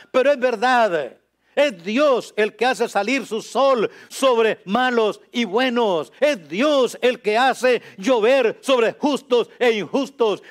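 high, loud, ringing sermon delivery; full-force preaching. Real, not acted. Preacher proclaims God's provision to his listeners, full-force preaching. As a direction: proclaim it to the whole congregation at the top of your voice.

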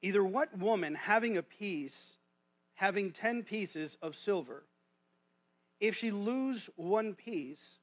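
slow, measured King James reading; scripture reading. Acted. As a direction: read the verses out to the congregation, deliberately. Reads scripture aloud.

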